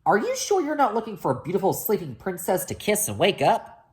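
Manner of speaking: a hint of a cackling witch; lightly voiced suspicious witch.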